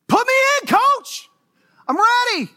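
loud high yell from the stands; eager shout. Pretend. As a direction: beg the coach to put you in, shouting. Pastor acts eager fan calling coach.

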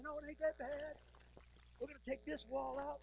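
lightly voiced cheerful designer; upbeat designer. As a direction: sell it like an eager designer. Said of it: "the designer comes in and says".

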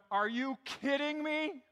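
high, slow disbelieving "are you kidding me"; incredulous crowd. Retold or imitated. Preacher voicing the shocked crowd hearing Jesus, slow and incredulous. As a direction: play the shocked listeners, drawn out. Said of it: "are going".

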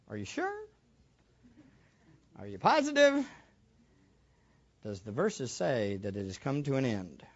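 normal talking voice

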